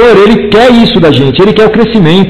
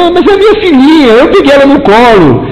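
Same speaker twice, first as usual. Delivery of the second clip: high, pleading mock-tearful protest; a touch of a crying father, pitched up; retold weeping father; 6 semitones higher than usual. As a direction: whine it high like a dad who can't let go.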